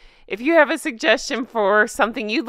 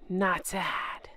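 whispered "Not sad", no voice, much softer; 5 semitones lower, 10 dB quieter than usual. Pretend. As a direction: breathe "Not sad" like a secret. Punchline after true story.

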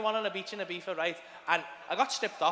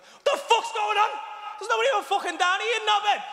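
high, loud exasperated yell; acted outraged bellow; fuming shout; 13 semitones higher, 4 dB louder than usual. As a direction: holler it crossly up the stairs. Acting out angry shouting upstairs.

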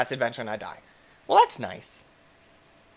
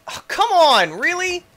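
much higher, louder exasperated cry; real frustrated outcry; 5 dB louder than usual. Real, not acted. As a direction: throw up your hands, come on, really. Frustration at game.

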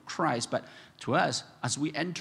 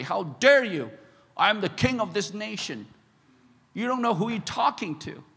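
indignant king, much louder, higher; mock royal fury; 6 semitones higher, 5 dB louder than usual. Preacher voicing himself as a proud king rebuked, furious. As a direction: bark it like an offended king. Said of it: "I would be so furious".

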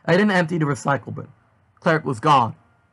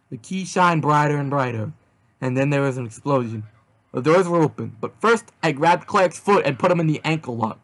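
ordinary delivery, nothing marked

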